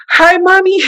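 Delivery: sweet false cheer for a worried mom; fake cheerful. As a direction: sound bright to hide the trouble. Fake cheerful greeting to mother.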